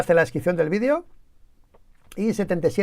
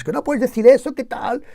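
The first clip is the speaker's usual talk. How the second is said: raised, high-pitched telling-off; mock scolding; 7 semitones higher, 3 dB louder than usual. Retelling [name]'s scoldings of [name], mock scolding. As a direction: imitate someone nagging in indignation. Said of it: "y unas broncas".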